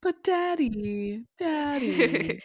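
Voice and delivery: put-on childish whine, high and slow on daddy; whiny child. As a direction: whimper like a kid begging his dad. S1 voices whining child.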